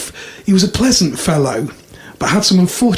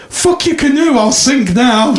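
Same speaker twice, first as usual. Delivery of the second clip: lightly voiced defiant New Yorker, higher-pitched; New Yorker character; 7 semitones higher than usual. Joke teller voices the New Yorker answering the chief, lightly acted defiance. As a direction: deliver the punchline as a mock New Yorker. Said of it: "And the New Yorker says".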